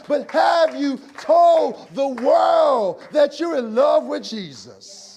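rising shouted sermon cadence; preaching at full force. Acted.

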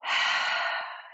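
long exaggerated exhale; big weird sigh. Shown as a demonstration. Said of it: "you take these big, weird breaths".

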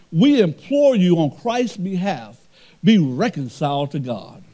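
loud, high, slow preacher's cry; booming pulpit delivery; full-force preaching. Acted. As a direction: preach it out to the whole church.

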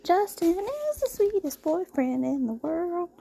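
high, sweet gushing voice. Real, not acted. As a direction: gush about him sweetly. Gushing about boyfriend, high voice.

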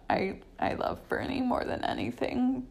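hushed and breathy; whispering. Real, not acted. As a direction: say it under your breath. Sudden hushed confession.

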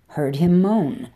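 ordinary delivery, nothing marked

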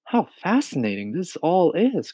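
put-on high boyish wonder; fascinated exclamation. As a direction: exclaim like an intrigued small boy. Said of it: "cried James".

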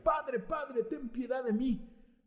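put-on high, slow pleading of a boy; pleading child voice. Isaac crying out to his father, high and pleading. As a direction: plead like a child about to be sacrificed. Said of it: "gritaba y decía".

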